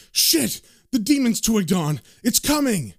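high, urgent acted alarm; sudden dread of what approaches; alarmed warning. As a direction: sound the warning, voice jumping up. Medium cuts in with alarm.

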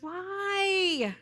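high, drawn-out whine; whiny voice. Shown as a demonstration. Preacher mimics Eli questioning his sons, whiny. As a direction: stretch the word into a whine. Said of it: "I'm putting my whiny voice on".

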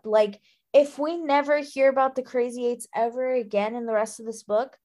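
ordinary delivery

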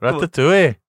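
a second failed stab at Welsh on "Ratatouille"; bad Welsh accent. Shown as a demonstration. Host retrying a Welsh accent, still badly. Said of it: "that was bad, wasn't it?".

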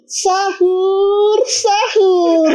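high, long-drawn eerie wake-up call; stretched high mock chant; strange sahur voice. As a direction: imitate a weird wailing sahur caller. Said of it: "iku aneh, Cuk, suara itu".

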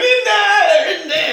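very high, loud mock shriek; hammed-up hysterical squeal; hysterical wife. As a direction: screech it like a panicking caller, half laughing. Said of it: "clearly hysterical wife".

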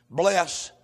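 normal talking voice

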